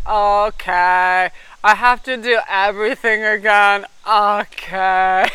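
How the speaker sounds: loud, droning, defeated Eeyore moan; dragging, hopeless Eeyore groan; gloomy Eeyore. Retold or imitated. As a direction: moan it like a put-upon donkey. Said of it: "I was literally like Eeyore at work".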